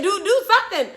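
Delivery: high, loud appeal to mother; retold panicked plea. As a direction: urge mom frantically, retelling it.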